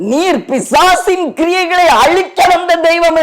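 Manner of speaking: high, ringing declaration of praise; exultant praise. Acted. Preacher voicing Moses' praise to God, high and exultant. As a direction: proclaim it high, stretching the phrase endings. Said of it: "மோசே எப்படி அவரை புகழ்ந்து பாடினார்".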